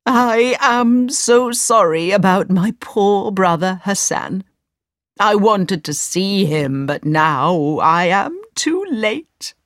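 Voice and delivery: slow, drawn-out mock lament; tearful old man. Wizard feigns grief for a brother, hammed-up tearful lament. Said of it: "he suddenly began to cry".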